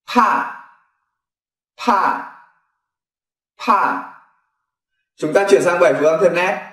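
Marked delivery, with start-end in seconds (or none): 0.1-4.1 "Thả" with a strong aspirated onset, first one higher; Korean word demo. Shown as a demonstration. Teacher modelling the Korean word for green onion, aspirated. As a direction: puff out "Thả" clearly. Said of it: "Ví dụ hành lá".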